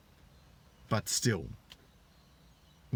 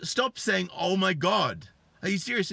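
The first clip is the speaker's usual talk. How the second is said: high, raised-voice outburst; exasperated husband; 9 semitones higher, 4 dB louder than usual. Retold or imitated. Husband cuts off his wife's repeated exclamations, exasperated. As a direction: imitate a husband cutting her off.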